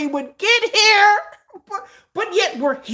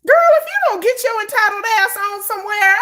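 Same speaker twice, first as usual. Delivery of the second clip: high, louder sassy telling-off; sassy dismissal; 10 semitones higher, 5 dB louder than usual. Retold or imitated. Telling off the entitled fan, high sassy dismissal. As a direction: shoo her off with attitude. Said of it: "I'm like".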